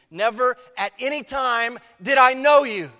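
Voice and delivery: NEVER hit high and loud, whole line declared; emphatic declaration. Acted. Jesus answers the pleaders, loud and emphatic. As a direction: proclaim it with full weight. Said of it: "There's an emphatic nature".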